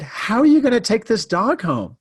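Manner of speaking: high, incredulous imitation of the friend; friend's disbelief. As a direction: ask it as if the idea is absurd. Friend doubts taking dog home.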